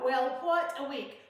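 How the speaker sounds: normal talking voice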